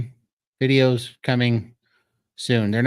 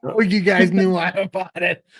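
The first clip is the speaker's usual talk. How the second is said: laughing; 6 semitones higher, 4 dB louder than usual. Real, not acted. Laughing at chat's joke.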